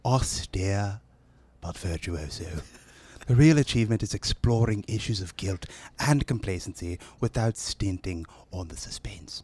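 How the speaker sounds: deliberate British delivery, trailing into softness; British accent. Acted. Knighted announcer closing a film review, British accent. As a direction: finish the review in a restrained British voice. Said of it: "That sounded northern".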